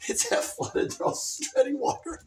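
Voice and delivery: ordinary talk, nothing marked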